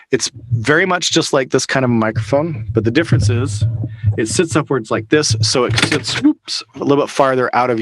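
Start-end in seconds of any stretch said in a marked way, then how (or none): none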